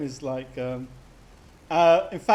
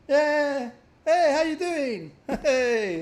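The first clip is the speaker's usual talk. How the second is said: much higher, loud, slow chummy greeting with a laugh; cheery Crow; 9 semitones higher, 6 dB louder, much slower than usual. Retold or imitated. Streamer mimicking teammate Crow barging in cheerfully. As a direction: play the overfriendly pest. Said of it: "Crow is like".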